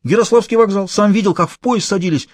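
a scared small-time crook blurting it out; a cornered accomplice answering the gang boss, high and nervous; frightened petty thief. A narrator's reading.

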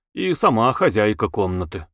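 ordinary delivery, nothing marked

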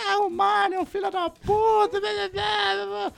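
high-pitched mock ranting voice; imitating a complaining friend. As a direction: mimic her whining rant, trailing into babble. (imitando amiga reclamando, voz aguda)

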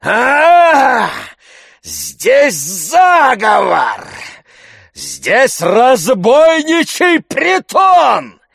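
slow, wailing shout climbing to a scream; screaming alarm. A narrator's reading. Tarantyev crying conspiracy and murder, screaming. Slapped man screaming for help.

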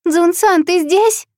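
high, loud calling. Acted. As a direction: shout the question out. Searching, calling for brother.

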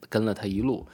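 ordinary delivery

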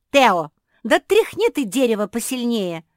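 loud, high exasperated order; impatient outburst. A narrator's reading. Куки telling Тео to shake the tree, impatient outburst. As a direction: burst out, out of patience. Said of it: "не выдержала Куки".

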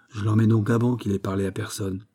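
ordinary delivery, nothing marked

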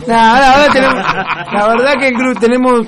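chuckling it out, amused; laughing. Real, not acted. Laughing at the joke. (riéndose mientras habla)

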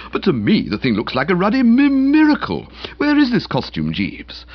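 high, excited delivery; amazed. A narrator's reading. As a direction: exclaim over a marvel. Boko amazed, demands the costume.

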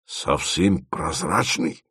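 low, slow, hushed wonder; awed question. A narrator's reading. Dima asks about the offered material, awed. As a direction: ask it slowly in awe.

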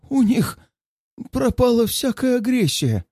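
high, rushed frightened voice; gasping out the words in fear; choking on words. A narrator's reading. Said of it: "едва не захлебываясь собственными словами".

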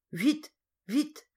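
high, urgent and breathless; urgent hurry. Acted. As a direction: hurry her along in a panic. Hurried plea at the door.

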